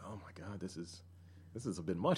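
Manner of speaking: low, soft embarrassed undertone; quietly mortified inner comment; retold muted aside. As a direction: say it under your breath, overwhelmed.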